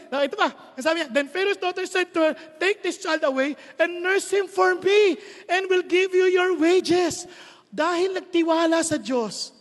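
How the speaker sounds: loud, high fervent preaching; rising revival-pulpit fervour; preaching at full force. Acted. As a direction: preach it out to the congregation. Preaching the Moses story loudly.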